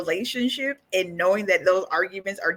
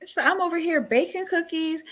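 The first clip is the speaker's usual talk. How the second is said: higher, indignant doll rant; angry Tiffany; 6 semitones higher than usual. Retold or imitated. Tiffany yelling at Chucky over the dishes, higher and indignant. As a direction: rant like an offended housewife.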